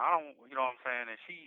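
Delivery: ordinary delivery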